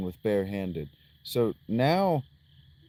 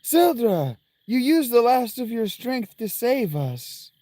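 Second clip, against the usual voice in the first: dramatic character voice; 10 semitones higher, 5 dB louder than usual. Acted. Voicing dramatic cutscene.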